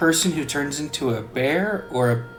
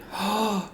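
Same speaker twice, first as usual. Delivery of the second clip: shocked gasp; 8 semitones higher, 6 dB quieter than usual. Acted. [name] gasps in shock.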